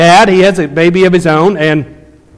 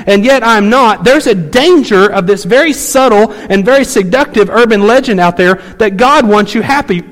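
higher, driving pulpit voice; preaching; 6 semitones higher than usual. Acted. Pastor pressing the congregation on a hard truth, full preaching.

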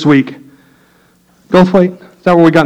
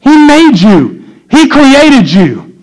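high, emphatic, building sermon delivery; full-force preaching; 11 semitones higher, 5 dB louder, slower than usual. Real, not acted. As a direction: blow the warning horn from the pulpit.

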